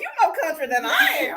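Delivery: teasing through laughter; laughing. Real, not acted. Teasing her guest while laughing. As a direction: laugh out the tease.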